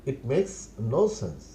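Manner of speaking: very slow, each word laid out for learners; slow model phrase. Shown as a demonstration. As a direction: spell it out slowly for the class. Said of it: "teacher repeats model phrase". (ধীরে ধীরে শেখানোর ভঙ্গিতে)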